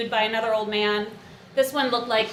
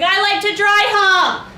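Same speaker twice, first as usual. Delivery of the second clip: higher, slow, loudly announced confession; loud mock blurt; 10 semitones higher, 8 dB louder than usual. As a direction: blurt the unsayable out loud, comically. Blurts out a forbidden thought.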